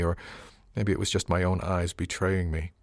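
ordinary delivery

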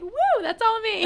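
high laughing woo, full of pride; cheer, taking all the credit; mock proud cheer. Friend mock-cheering her credit for the couple. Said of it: "And you're like".

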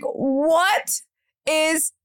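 high, incredulous what is happening; baffled at being recognized, voice jumping up; stunned disbelief. Retold or imitated. Actress recounting fans in Walmart, amazed disbelief. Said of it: "I was like".

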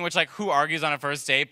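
ordinary talk, nothing marked

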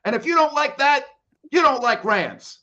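defiant rant ending. Acted. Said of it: "that's my rant for today".

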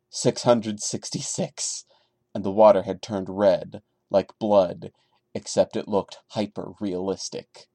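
low, hushed creepypasta reading; story reading. Acted. Reading the Sonic creepypasta aloud to viewers, hushed and low. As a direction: read the creepy tale in a low, even hush.

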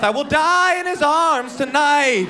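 high, slow, hammed-up tragic lament; mock tragic despair; melodramatic. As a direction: sing it like a doomed romantic hero. Melodramatic inner panic.

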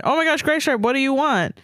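high-pitched, lightly yelped character line; mild yelp. A narrator's reading. Fanfic heroine asks what Graystripe wants, mock yelp. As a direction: read it as a squealy little yelp. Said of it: "she yelped".

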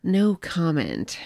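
very slow, spaced-out "no comment"; deadpan dodge. Acted. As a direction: stretch both words out to dodge the question. Co-host dodges a teasing question.

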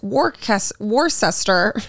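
"Worcester" sounded out in three syllables, wor-ses-ter; spelling mispronunciation. Retold or imitated. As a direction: imitate outsiders reading "Worcester" letter by letter as wor-sester. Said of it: "And everyone's like".

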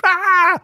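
high squealing scream; mock scream. Model screaming at a sudden bang, high mock shriek. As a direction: shriek in fright. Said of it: "the Brazilian screamed".

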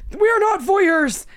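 high, loud, slow outraged shout; hammed-up furious dad yell; imitating angry dad. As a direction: bellow it like a scandalized father. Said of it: "I'll never forget Dad".

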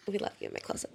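whispered tease. Real, not acted. Teasing aside.

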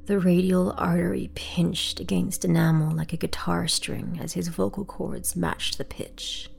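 ordinary delivery, nothing marked

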